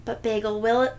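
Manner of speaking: plain reading voice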